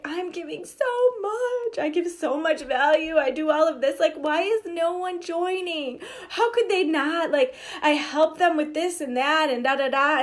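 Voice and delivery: high, whining complaint with a cry in it; whiny self-pity. Retold or imitated. Coach imitates her old self complaining, whiny and high. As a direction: moan about nobody joining. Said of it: "I used to be like".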